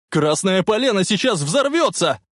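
high, agitated warning; strained, excited shout. A narrator's reading. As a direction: shout the warning at full stretch. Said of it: "срывая голос, прокричал Марти". (взволнованный крик с надрывом)

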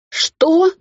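high, loud cry of disbelief; outraged, incredulous yelp; shocked yell. A narrator's reading. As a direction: shoot the question up high and loud. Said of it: "заорала я".

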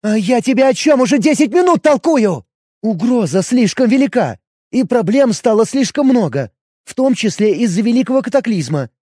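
jealous elf questioning her man; elf woman voice. Acted.

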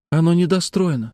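put-on high-pitched childlike observation; little girl remarking. A narrator's reading. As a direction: voice a young girl. Narrator voices the young girl.

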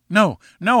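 crying out. A narrator's reading. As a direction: cry out in quick objection. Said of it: "I cried".

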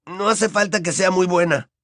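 ordinary delivery

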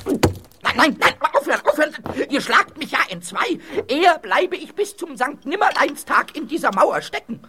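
high, frantic pleading; panicked protest. Acted. Ghost begs castellan to stop chiselling, high panicked protest. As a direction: protest in alarm at being hammered.

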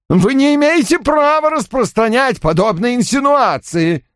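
громкий возмущённый крик, голос сорван вверх; кричать, защищаясь от обвинения. A narrator's reading. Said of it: "голос поднялся до крика". (Дэниел кричит на Вульфа, возмущённо)

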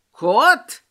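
loud, incredulous snap; menacing bark. A narrator's reading. Тётка rejects the cat excuse, barking. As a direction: bark it back as a threat. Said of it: "рявкнула тётка, грозно нахмурив брови".

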